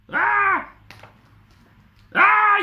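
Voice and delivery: high, loud storybook scream; two shrieks of alarm as Granny; Granny's scream. Acted. Granny seeing the wolf, high loud scream. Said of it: "yelled Granny".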